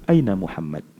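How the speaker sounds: quiet, wondering; softened question. Acted. Umar now asking softly where the Prophet is, quiet wondering. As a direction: ask it gently, almost to yourself. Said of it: "Intonasi dia berubah dah".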